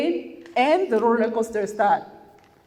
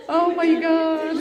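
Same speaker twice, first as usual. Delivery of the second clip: breaks into a slow, high laugh; real relieved laughter; 8 semitones higher, slower than usual. Real, not acted. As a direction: laugh it off after the tears. End of emotional talk, laughs.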